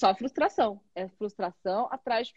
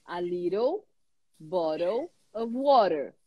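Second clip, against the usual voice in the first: American English, flapped t in "little" and "water"; American accent; 3 semitones lower, slower than usual. Shown as a demonstration. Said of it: "Como é que o americano fala".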